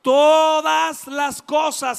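slow, loud cry of todas las cosas; preacher crying out to the church, loud and slow; preaching shout. Real, not acted. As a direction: lift it to the rafters. Preacher's climax.

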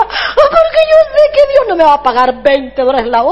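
hammed-up sobbing complaint; mock crying. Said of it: "¿Por qué llora?".

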